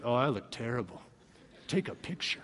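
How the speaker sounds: low, hushed aside; soft, deflated mock self-pity; mock dejection. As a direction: mumble it low as a joke. Mock posting of an unflattering photo.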